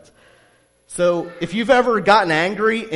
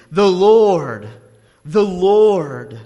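proclaiming loudly; 2 semitones higher, 9 dB louder, much slower than usual. Acted. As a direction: ring out each Lord with full force. Reading scripture proclamation.

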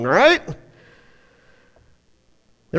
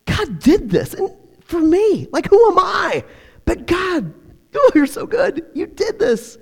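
breathless wonder at God's goodness; awed gratitude; 7 semitones higher than usual. Pretend. Elizabeth's awed gratitude imagined.

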